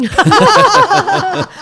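big high laugh bursting out; loud burst of laughter. Real, not acted. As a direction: crack up loudly at the joke. Joke about ancient drug story. (大声爆笑)

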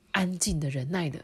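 usual speaking voice

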